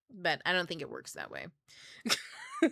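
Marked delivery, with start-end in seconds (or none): 1.8-2.6 high-pitched real laugh. Real, not acted. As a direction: burst into high giggles. Laughs at her own experiment.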